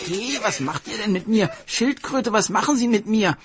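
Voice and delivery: shrill-pitched panic as guards grab him; alarmed cry. Acted. Hare seized by palace guards, crying out in alarm.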